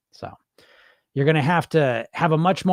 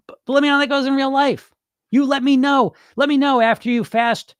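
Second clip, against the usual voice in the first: daring challenge; 8 semitones higher, 4 dB louder than usual. Real, not acted. Challenging the dieter.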